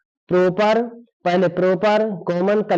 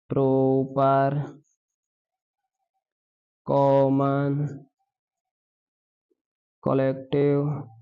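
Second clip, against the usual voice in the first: lower, softer, very slow list with pauses; slow dictation; 4 semitones lower, 4 dB quieter, much slower than usual. Shown as a demonstration. Teacher listing noun types for students to write, slow. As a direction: spell out each type to copy. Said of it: "dictating list of noun types".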